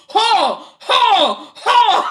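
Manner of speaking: high, loud, drawn-out shouts breaking into laughter; exaggerated one-word bellow, each just as loud; mock accusing shout. Co-host boiling down the lawyer's hostile questioning, mock accusing shout. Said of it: "him just being like".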